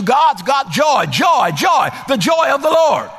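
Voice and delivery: high shouted praise; joyful shout. Acted. Preacher shouting the joy of the Lord, loud and high. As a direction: cry it out to the rafters. Climax of the sermon.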